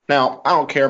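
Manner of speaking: ordinary talk, nothing marked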